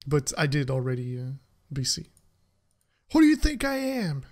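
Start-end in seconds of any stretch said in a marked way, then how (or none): 3.1-4.2 high, hammed-up indignation; mock-arrogant streamer reply to chat; mock offended. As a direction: play insulted to tease the chat.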